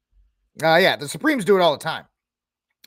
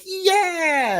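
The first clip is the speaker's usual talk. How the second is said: high excited cheer; cheering yeah. Retold or imitated. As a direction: whoop it like a fan. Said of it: "all the gun community is like".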